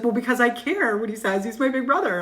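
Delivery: lightly voiced upset daughter; mock whiny daughter. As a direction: imitate a pouting upset daughter. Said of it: "She's like".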